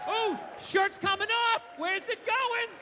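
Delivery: hammed-up delighted onlooker; a wife thrilled that the shirt is coming off, squealing high; mock excited gasp.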